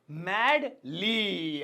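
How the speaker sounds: "Madly" very slowly, both syllables held; drawn-out repetition. Shown as a demonstration. Teacher introducing the next adverb to students, drawn out.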